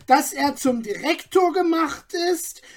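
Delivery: high, shrill-edged gossip, full of herself; piercing self-important voice. A narrator's reading. Gossip Margaret answers the crowd, piercing and self-important. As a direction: announce it like the town's know-it-all. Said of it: "die Eibisch Margret".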